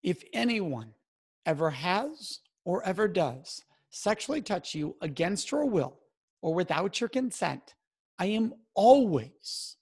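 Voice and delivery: slow, deliberate and sincere, with weighted pauses; supportive disclosure script. Shown as a demonstration. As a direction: promise support slowly, leaning on ALWAYS. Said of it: "here's the language".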